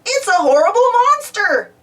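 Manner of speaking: high, loud panicked cry; screamed in fright. A narrator's reading. Muck fleeing Spud's scary noises, frightened scream. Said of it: "Muck screamed".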